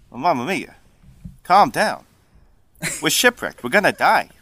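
Italian sliding into New Jersey; exasperated Jersey-Italian character; hammed-up New Jersey Italian; New Jersey Italian accent. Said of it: "New Jersey and Vodachi are just connected".

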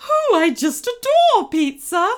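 loud, high gushing goblin queen; squealing villain, outrage forgotten; gushing delight. A narrator's reading. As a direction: gush with sudden delight. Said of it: "suddenly forgetting about her outrage".

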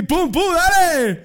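high, loud, slow "pum, pum, dale"; mock honking call. Friends arrive honking for the birthday, loud mock call. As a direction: bang it out like friends calling you out.

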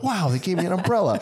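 high, fast mock glee; rushed imitation of her own delight. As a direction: gush like a kid given a present. Recalls happiness at the gift.

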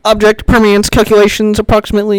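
high, loud robotic computer read-out; mock robot voice. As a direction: announce it like a deadpan machine. Jokey robotic pronouncement.

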